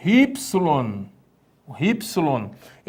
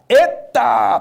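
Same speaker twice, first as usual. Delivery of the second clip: loud call; 7 semitones higher, 6 dB louder than usual. Shown as a demonstration. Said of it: "Prestou atenção?".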